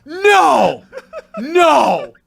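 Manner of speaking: high, long wail of protest; dismayed shouting. Real, not acted. Host begging friend to take it back, dismayed shouting. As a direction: cry out in appalled disbelief.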